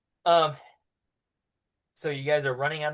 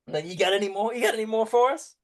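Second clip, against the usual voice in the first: eager guard; 7 semitones higher, 5 dB louder, faster than usual. Acted. Guard asking for more.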